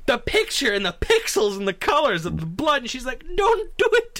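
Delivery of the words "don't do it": very high, tearful-sounding squeal; mock terror. Lady begging phone guy to stop, mock terror. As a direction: imitate a terrorized lady, close to laughing. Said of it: "she's being terrorized".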